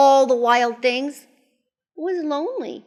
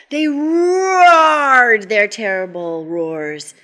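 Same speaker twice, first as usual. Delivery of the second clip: loud, slow, stretched-out "roared"; roaring narration; 8 dB louder, slower than usual. A narrator's reading. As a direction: draw out "roared" like a roar. Acting out the roar in narration.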